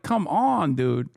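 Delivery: ordinary delivery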